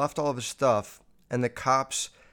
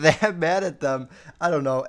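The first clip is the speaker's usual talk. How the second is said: ordinary delivery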